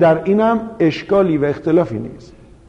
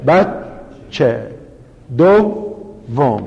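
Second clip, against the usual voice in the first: بچه and دوم stretched out very slowly, syllable by syllable; 4 semitones lower, much slower than usual. Shown as a demonstration. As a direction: spell out each syllable like a first-grade teacher. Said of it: "ولی الان میگه چی؟". (خیلی آهسته و هجا به هجا، مثل معلم کلاس اول)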